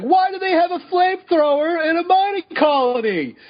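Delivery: very high-pitched indignant rant voice; Al Berg impression. Podcaster mimicking absent co-host's rant about a movie, impression. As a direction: rant in a strained, high, put-on voice. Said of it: "That was my Al Berg impression".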